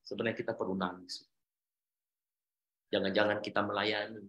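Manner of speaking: usual speaking voice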